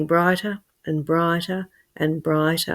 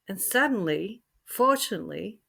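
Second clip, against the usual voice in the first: ordinary delivery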